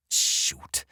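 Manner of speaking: breathy whispered curse. Acted. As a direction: whisper it under your breath. Muttered curse in car.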